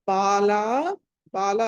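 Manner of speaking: బాల with both vowels drawn long. Shown as a demonstration. Teacher shows vowel length, బాల with two long a's. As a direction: hold both syllables of బాల long, baa-laa. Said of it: "రెండింటికి దీర్ఘము".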